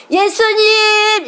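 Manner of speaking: high, loud, long-held shout of 예수님; full-voiced calling out. Shown as a demonstration. Mother shows children by the river how to call, full-voiced. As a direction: call 예수님 out with all your might, stretched. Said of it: "이렇게 부르면 못해요".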